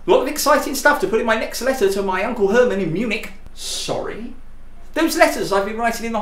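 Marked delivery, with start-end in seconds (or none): none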